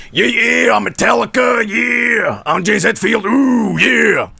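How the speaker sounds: high, shouted rock-singer yowl. Retold or imitated. Comedian mocking Metallica's singer at an award show, shouted rock parody. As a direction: belt out drawn-out mock metal "yeah"s. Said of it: "he's going".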